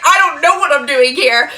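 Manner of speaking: helpless outburst, played up; mock panic. Acted awkward reply to angry dad.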